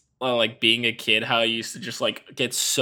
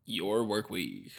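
echoing whisper; 5 semitones lower, 13 dB quieter, slower than usual. Acted. Spooky echo of work week.

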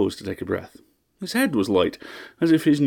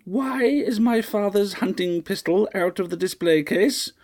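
very high, slow, grating matron; grating old lady; 11 semitones higher than usual. A narrator's reading. Mistress demanding an answer from her butler, high and grating. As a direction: scrape it out like a rusted latch. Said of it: "Her voice grated like a rusted door latch".

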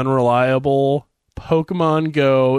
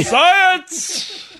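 shouting; 4 dB louder than usual. Retold or imitated. People shouting at scientist.

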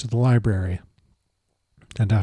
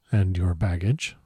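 low, soft, silky question; smooth soft question; 5 semitones lower, 7 dB quieter than usual. Acted. The Count probing Jonathan about his baggage, softly. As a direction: ask it smooth and diabolical.

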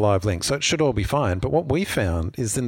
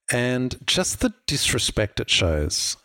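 ordinary delivery

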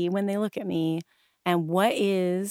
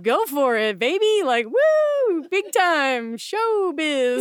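high, loud hammed-up showbiz pitch; showbiz pep talk; 9 semitones higher, 5 dB louder, slower than usual. Performer mock-urging would-be chorus members, hammed-up showbiz pep. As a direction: belt it out like a chorus-line cheerleader.

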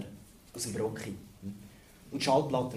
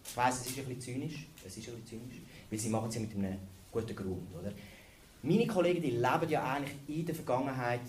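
normal talking voice

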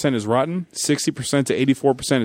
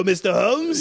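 normal talking voice

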